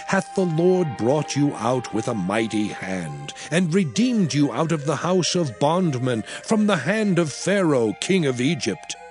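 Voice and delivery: ordinary talk, nothing marked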